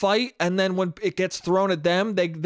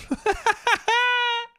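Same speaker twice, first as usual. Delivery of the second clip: high burst of laughter; real high-pitched laughing fit. Real, not acted. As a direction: laugh at your own story. Laughs at own story.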